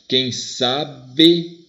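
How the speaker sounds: "Quem sabe" split into slow, separate syllables. Shown as a demonstration. Teacher scanning the next verse, "quem sa-be" syllable by syllable. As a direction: space it out as "quem sa-be". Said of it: "vamos ver quantas sílabas".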